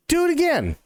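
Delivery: awed bartender begs for another jump; eager Joe. Retold or imitated. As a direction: push him, thrilled to see it again. Joe demands a repeat.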